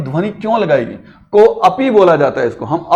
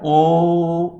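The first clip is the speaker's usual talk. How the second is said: "ओ" held long and level; long vowel; 3 dB quieter than usual. Shown as a demonstration. Teacher telling students how far to say it, long "ओ". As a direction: stretch "ओ" out slowly for three beats. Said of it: "अब यहां कहना".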